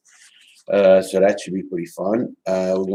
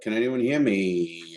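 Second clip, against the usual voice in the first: normal talking voice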